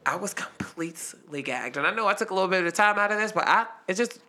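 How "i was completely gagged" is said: slow, hushed disbelief; stunned whisper. Real, not acted. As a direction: drop to a slow whisper for emphasis. Stunned punchline, repeated under breath.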